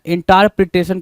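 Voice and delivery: इंटरप्रिटेशन with "taar" instead of "ter"; misspelling read out. Shown as a demonstration. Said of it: "कुछ नहीं होता है".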